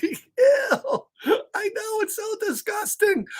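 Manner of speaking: squealing high mock disgust; hammed-up grossed-out squeal; playful high-pitched ew. Reacting to gross book.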